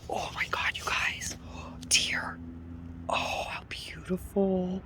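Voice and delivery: hushed, breathless whisper; awed whisper. Real, not acted. Driver whispering to viewers on spotting deer, awed whisper. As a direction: whisper so the deer aren't startled.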